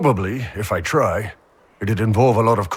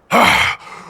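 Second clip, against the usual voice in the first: relieved sigh; 6 semitones higher, 5 dB louder than usual. Real, not acted. Relief after exercise.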